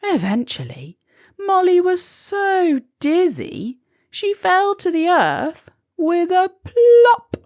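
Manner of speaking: plain reading voice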